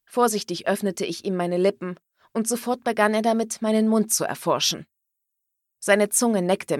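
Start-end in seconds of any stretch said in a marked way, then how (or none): none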